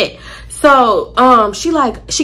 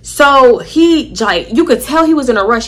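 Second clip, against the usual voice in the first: normal talking voice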